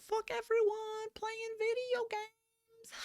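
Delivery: a cartoonish squeal of defiance; gleeful rebel. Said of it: "I could just be like".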